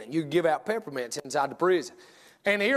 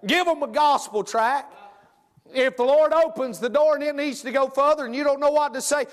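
higher, urgent preacher push; fervent preaching; 6 semitones higher, 3 dB louder than usual. Acted. Preacher urges church higher and fervent. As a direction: drive the exhortation home.

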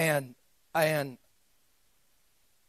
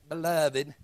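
put-on lower-pitched Alabama accent on "beloved"; Ken Bowman; 3 semitones lower than usual. Preacher imitating a guy from Alabama, Alabama accent. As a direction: mimic an Alabama man's accent, lightly. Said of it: "Ken Bowman".

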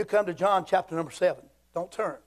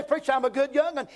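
mock objection; 7 semitones higher than usual. Voices an objecting churchgoer.

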